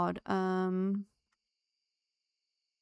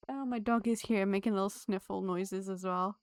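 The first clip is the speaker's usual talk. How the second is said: ordinary delivery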